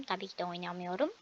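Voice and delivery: whispered aside to the viewers. Real, not acted. Child whispering a confession to viewers. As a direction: drop to a quick secret whisper.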